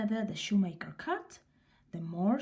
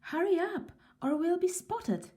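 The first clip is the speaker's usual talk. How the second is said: much higher, hurried little elf; urgent elf; 8 semitones higher than usual. A narrator's reading. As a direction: hurry your partner along. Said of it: "said the first elf".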